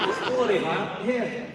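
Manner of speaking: mock Scottish accent, hammed up; bad Scottish accent. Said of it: "That was a very bad Scottish accent".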